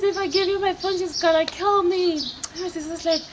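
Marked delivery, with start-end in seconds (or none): 0.0-2.1 high, alarmed retold plea; panicked. Girl tells sister about the caller's threat, panicked. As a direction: act out panic in a raised pitch.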